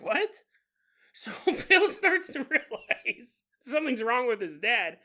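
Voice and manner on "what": high disbelieving yelp; shocked what. Acted. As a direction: react in sudden disbelief. Shocked reaction.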